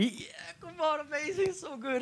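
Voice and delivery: airy high-pitched hype; excited young folks. Retold or imitated. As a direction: cheer it on like an eager youngster. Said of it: "the young folks like".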